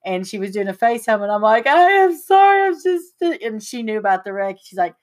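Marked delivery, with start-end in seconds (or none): 1.7-3.2 very high, loud wailed apology; breaking off in exaggerated upset; mock crying. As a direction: imitate a tearful apology, hammed up. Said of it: "I couldn't quit crying".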